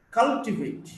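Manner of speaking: CUL in "cultivate" punched, the rest lighter; first-syllable stress. Shown as a demonstration. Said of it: "first syllable".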